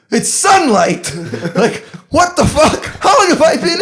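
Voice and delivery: retold shock with laughter; amazed disbelief. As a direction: sound stunned and amused.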